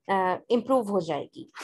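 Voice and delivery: normal talking voice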